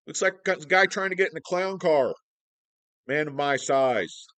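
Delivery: loud, high ranting; hammed-up indignant yelling; mock protest shout. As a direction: holler it like a one-man protest. Mock ranting about car seats.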